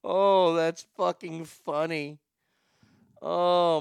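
ordinary talk, nothing marked

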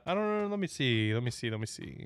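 fading to a mumble; trailing off. Real, not acted. Fades while searching.

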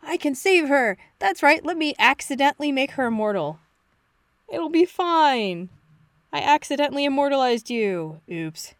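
high, eager hammed-up Doctor; Doctor impression. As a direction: play the Doctor carelessly cheerful. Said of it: "he takes that to mean".

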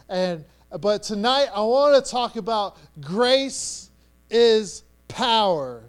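ordinary talk, nothing marked

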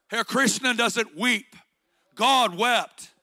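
loud, building sermon cadence, hammering each break through; rising fervor, each phrase pounded home; preaching at full force. Acted. Preacher rallying the congregation, loud and fervent.

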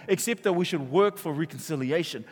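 ordinary talk

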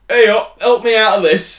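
loud mock call from a stuck driver; hammed-up shout for a tow; calling out for help.

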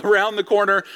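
normal talking voice

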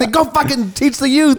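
high-pitched mock-yelled comeback; angry retort. Fan telling off supposed youth pastor, angry retort. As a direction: fire back in a retold shouting match. Retold yelling match with scalper.

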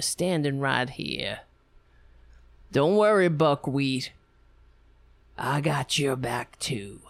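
low, pompous, self-important drone; mock blowhard congressman. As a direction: lecture like a puffed-up veteran politician.

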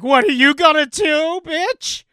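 high, loud taunting thug; mock tough-guy yell. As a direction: sneer it like a street tough. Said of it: "And he's like".

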